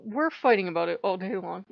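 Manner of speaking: ordinary delivery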